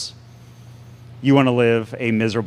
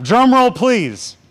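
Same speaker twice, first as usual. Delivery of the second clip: excited; 11 semitones higher, 5 dB louder, much slower than usual. Acted. Asks crowd for drum roll.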